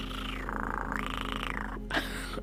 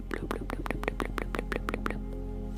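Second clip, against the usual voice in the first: bloop mouth sounds. Shown as a demonstration. Mouth sounds in ASMR stream.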